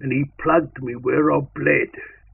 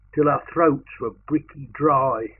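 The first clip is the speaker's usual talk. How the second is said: usual speaking voice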